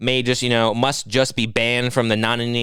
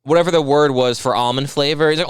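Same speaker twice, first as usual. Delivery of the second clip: ordinary talk, nothing marked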